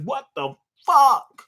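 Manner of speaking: slow, high stunned disbelief; Sanji stunned. Retold or imitated. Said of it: "Fucking Sanji surprised".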